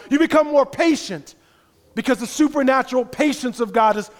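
loud, high pulpit preaching; fiery sermon climax; preaching at full force. Acted. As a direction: drive it home to the congregation.

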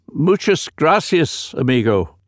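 slow, high, exaggerated Spanish-ish accent; a Geordie trying to sound Spanish; strange foreign accent. A narrator's reading. Rescuer thanking the narrator in a strange foreign accent. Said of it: "in a strange accent that was totally foreign".

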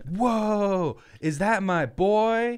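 high, drawn-out hype greeting; excited greeting. Acted. Indrid Cold spotting his boy across the place, excited greeting. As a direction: call out in delighted disbelief to a buddy.

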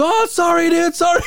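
high panicked shouting apology. Retold or imitated. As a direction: yell sorry like you're terrified. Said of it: "He says".

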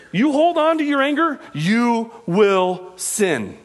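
high-pitched, impassioned sermon delivery; forceful preaching. Real, not acted. Preacher urging the church to seek repentance, forceful preaching.

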